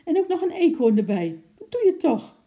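high, loud, rapid tirade; shocked ranting. A narrator's reading. Wife rants at husband after her fright, angry. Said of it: "Ze ging tekeer omdat ze zo geschrokken was".

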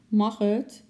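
high, loud little plea; pleading. A narrator's reading. Girl begs her father to let her stay, pleading. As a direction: beg for permission, voice lifted high. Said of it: "vroeg Lotje smekend".

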